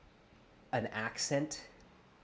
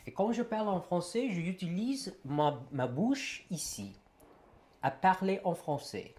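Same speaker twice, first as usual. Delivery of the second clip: higher-pitched French demonstration; speaking French; 7 semitones higher than usual. Shown as a demonstration. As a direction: speak French, placing the sound as a native would. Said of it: "I'm going to speak in French".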